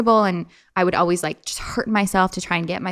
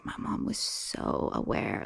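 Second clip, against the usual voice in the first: near-whisper of admiration; whispered, marvelling realization; hushed awe; 12 dB quieter, slower than usual. Real, not acted. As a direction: drop low and slow, almost voiceless. Hushed awe at her mother.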